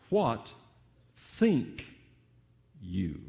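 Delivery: "What think you" with a pause after each word. Shown as a demonstration. Preacher posing a question to the congregation, word by word. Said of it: "change the emphasis here".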